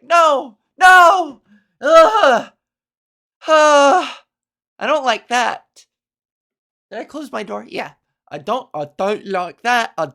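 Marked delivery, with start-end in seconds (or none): 0.0-5.9 loud, high disgusted no and groans. Pretend. Reacting to the kid's gross line, loud disgust. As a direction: recoil loudly in disgust. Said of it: "And you're like".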